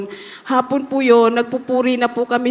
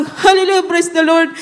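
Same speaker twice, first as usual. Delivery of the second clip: a raised, higher cry of Hallelujah; retold shout of praise; 5 semitones higher, 4 dB louder than usual. Testifying to the congregation about her birth, a raised joyful shout. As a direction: lift it up like a joyful shout in labor. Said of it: "sumigaw po ako ng".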